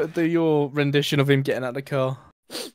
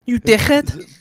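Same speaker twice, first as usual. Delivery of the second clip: put-on higher, louder raging Scouse accent; angry Scouse rant; 9 semitones higher, 6 dB louder, slower than usual. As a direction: tear into him like a furious Liverpudlian. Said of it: "Go on, [name]".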